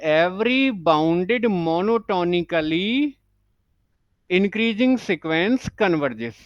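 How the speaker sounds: very slow, word by word, for notes; slow dictation. Shown as a demonstration. Teacher dictating the English note to students, very slowly. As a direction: dictate it so students can write along.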